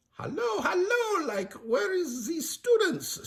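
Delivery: put-on very high, silly French accent; Shaggy's bad French accent. Shaggy disguised as a French chef, bad French accent. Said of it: "bad French accent".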